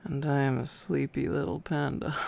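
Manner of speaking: usual speaking voice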